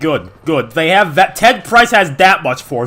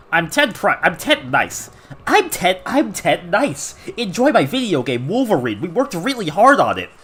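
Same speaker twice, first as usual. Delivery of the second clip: singsong, bobbing executive introduction; Ted Nice. Acted. Nice counterpart pitching his games, singsong put-on voice. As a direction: play the boss's nice alternate self. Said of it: "Ted Nice is his interdimensional counterpart".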